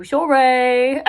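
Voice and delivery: loud, drawn-out mock British-Australian accent; bad accent. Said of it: "that's a bad accent".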